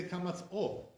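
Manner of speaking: o as a rounded oh. Shown as a demonstration. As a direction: kamatz o, the vowel said oh.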